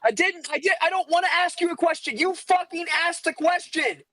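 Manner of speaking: can't believe he's being blamed; exasperated protest. Real, not acted. Accused friend protests back, exasperated and high. Friends arguing over who asked.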